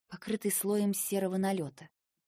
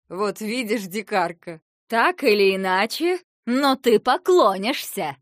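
gloating shout; 3 semitones higher, 11 dB louder than usual. A narrator's reading. Princess gloats over kneeling captive.

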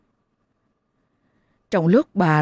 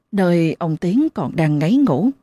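plain reading voice

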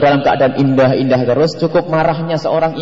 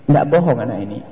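ordinary talk, nothing marked

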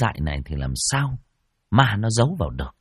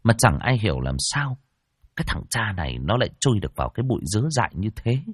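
ordinary delivery

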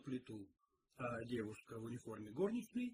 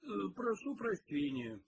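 higher, mildly apologetic; regretful; 6 semitones higher, 3 dB louder than usual. A narrator's reading. Detective apologises to the cook, mildly regretful. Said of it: "с сожалением проговорил Пил".

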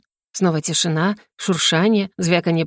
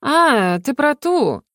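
offhand, higher-pitched dawning recognition; casual; 6 semitones higher, much slower than usual. A narrator's reading. Marina brushing off her sister's news, studied casualness. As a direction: toss it off as if unimportant. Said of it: "небрежно добавила она".

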